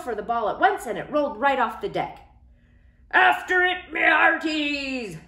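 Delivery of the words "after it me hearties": much higher, loud shouted order; pirate command. Acted. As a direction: command the crew to chase. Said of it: "Braidbeard commanded".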